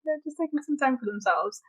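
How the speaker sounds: high, soft sing-song excuse; mock innocent high voice; mock defensive. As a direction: pretend to sweetly make excuses.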